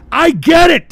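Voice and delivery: high, loud burst; sarcastic shout at a viewer; sudden shout. Real, not acted. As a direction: yell it at the commenter. Angry outburst at a commenter.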